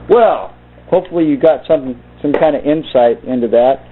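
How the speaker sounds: plain reading voice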